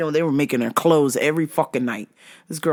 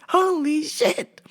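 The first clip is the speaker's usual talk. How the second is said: higher, whimpering disbelief; mock crying; 12 semitones higher, slower than usual. Real, not acted. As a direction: say it like almost sobbing. Repeat with crying sound.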